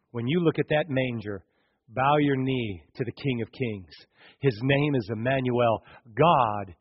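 ordinary talk, nothing marked

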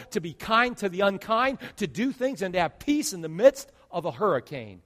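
rising loud preacher build; loud sermon build toward the congregation; full-force preaching. Acted. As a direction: preach it out, pressing each line.

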